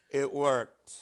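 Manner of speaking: ordinary delivery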